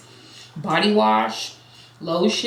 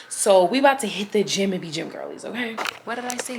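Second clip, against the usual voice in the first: ordinary talk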